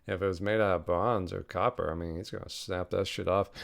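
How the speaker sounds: ordinary delivery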